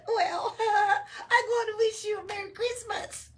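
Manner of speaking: high, loud elf voice through giggles; mock him while laughing. Elf mocking Oscar's reply, laughing high and loud. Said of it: "They exploded into laughter".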